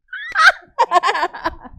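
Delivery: loud, high real laugh; burst of laughter. Real, not acted. Speaker cracking up at her own refusal, loud laughter.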